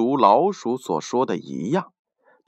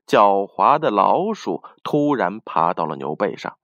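plain reading voice

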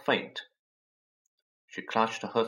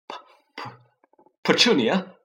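stammering, higher gasping call; gasped stammer; 6 semitones higher, 6 dB louder than usual. A narrator's reading. Vernon gasps his wife's name, stammering in shock. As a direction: choke out her name in shock. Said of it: "he gasped".

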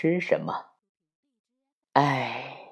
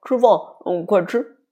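high mumbling through food; 10 semitones higher, 5 dB louder than usual. A narrator's reading. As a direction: mutter with a mouthful. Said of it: "妈妈也嘟嘟囔囔地说".

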